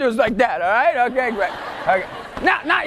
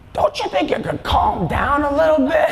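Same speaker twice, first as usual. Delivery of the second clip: put-on elderly lady voice; mock old-lady voice; nice old lady; 2 semitones higher than usual. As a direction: imitate an old lady gently chiding. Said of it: "seventy, seventy-year-old and up are there".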